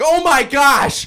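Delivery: high, loud, drawn-out shriek of shock; re-enacted startled shout. Retelling dad's flashlight scare, startled shout. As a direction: play up the jump scare at full volume.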